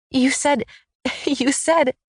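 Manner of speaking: high-pitched, breathless words through helpless laughter; giggling so hard she can barely speak; laughing hard. A narrator's reading. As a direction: squeeze the words out while cracking up. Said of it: "practically hyperventilating because I'm laughing so hard".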